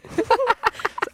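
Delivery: giggling; high laugh. Real, not acted. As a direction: burst into giggles. Laughs at own accent.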